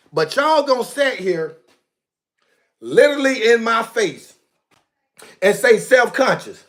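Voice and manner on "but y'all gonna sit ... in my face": usual speaking voice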